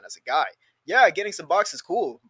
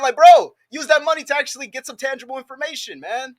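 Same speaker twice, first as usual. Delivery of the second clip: fired-up urging; 10 semitones higher, 8 dB louder than usual. Real, not acted. Urging guys, voice jumps up.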